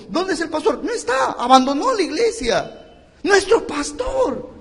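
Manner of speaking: high, loud, anguished retelling; retold tearful news. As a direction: cry out the news of the lost pastor. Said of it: "Te voy a contar esto, llorando".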